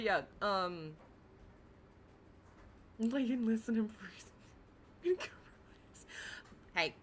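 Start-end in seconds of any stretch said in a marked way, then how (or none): 3.0-4.2 whispering. Pretend. Whispered joke.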